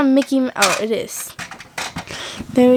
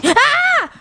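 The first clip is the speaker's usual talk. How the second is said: short high shriek of fright; startled scream. Acted. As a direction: shriek at the spider. Kids find a spider.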